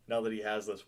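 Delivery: ordinary delivery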